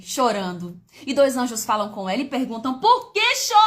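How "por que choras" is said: louder and higher, a ringing cry; shouted question. Acted. Preacher shouts the angel's question to her viewers. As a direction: call it out to the crowd.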